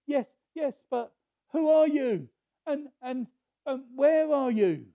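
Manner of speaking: high, loud, anxious asking; panicked man clinging to a branch; desperate plea to the voice; frantic questions. Retold or imitated.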